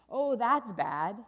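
loud, slow, drawn-out dismay; horrified refrain. Acted. Storyteller reacts to the snake, slow loud horror. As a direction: dread the news heavily.

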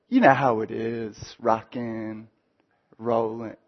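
teen boy acting cool for his friends; cool guy. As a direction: shrug it off, too cool to care. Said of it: "turns into cool guy".

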